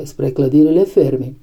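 usual speaking voice

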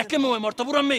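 urlato forte, più acuto; grido. Acted. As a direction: sbottare gridando. Figlio urla contro la madre. (il figlio grida alla madre in pieno litigio)

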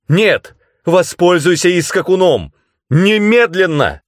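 high, loud, insistent command; urgent shouted order. A narrator's reading. Tananda orders Skeeve to escape by the hopper, urgent shout. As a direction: cry out the order, drawing out the last word.

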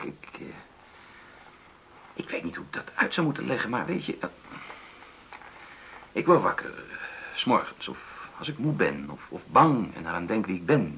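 ordinary delivery

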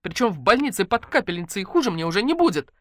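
exasperated patient; barking. A narrator's reading. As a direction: insist in a high, cross voice. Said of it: "рявкнула Наташка".

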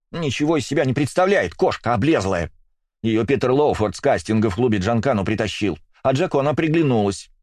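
heated, high-pitched tirade; angry rant. A narrator's reading. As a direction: keep venting the jealousy. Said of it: "Вдруг взорвалась та". (злобная тирада)

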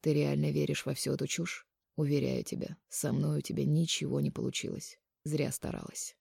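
very soft, restrained disappointment; weary sigh. A narrator's reading. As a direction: reproach her quietly, sighing. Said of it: "вздохнул Полонский". (тихо и устало, с укором)

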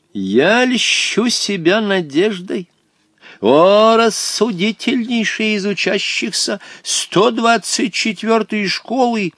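put-on high, slow ornate old man; flowery old Hottabych. A narrator's reading. Old companion addressing the wise pupil, grandly courteous. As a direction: orate in grand, drawn-out flattery.

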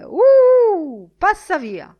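very high, loud yell at the herd; drover's shout. A narrator's reading. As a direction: drive the cattle off with a bellow. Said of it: "gridò la voce del mandriano". (urlo del mandriano, acuto e forte)

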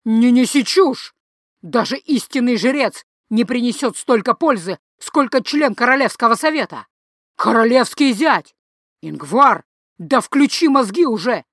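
loud, forceful shouting; angry father shouting. Acted. Father shouting down his son's argument, loud. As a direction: cut him off and shout him down.

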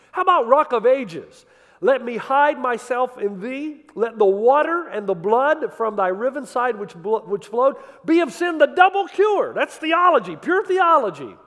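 loud, high, fervent preaching; preaching at full force. Acted. Preacher quoting a hymn to the congregation, loud and fervent. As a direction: preach the hymn lines out to the back row.

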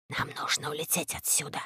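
soft, low hush; a quiet rope's hush. A narrator's reading. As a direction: say it under your breath. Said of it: "тихо сказал Шрип".

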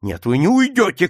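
high, loud refusal; shouting. A narrator's reading. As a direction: forbid him with a shout. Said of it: "крикнул Скалли". (властный крик)

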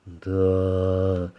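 long, level, astonished drawl; surprised drawl. A narrator's reading. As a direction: stretch the single word in muted amazement. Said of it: "удивлённо протянул офицер".

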